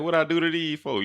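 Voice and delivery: asking why strangers are jumping him; bewildered protest. Retold or imitated. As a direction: ask it in high, baffled disbelief. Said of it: "I'm like".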